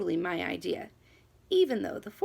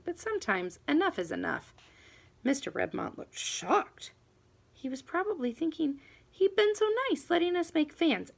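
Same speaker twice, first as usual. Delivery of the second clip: usual speaking voice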